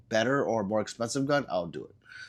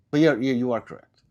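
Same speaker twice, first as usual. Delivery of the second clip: usual speaking voice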